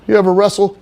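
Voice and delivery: high, loud dopey bro voice; Johnny Canine's voice. Retold or imitated. Johnny greeting Henry, in a high loud bro voice. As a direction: pitch way up and push it out like a meathead buddy. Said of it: "that's how he would talk".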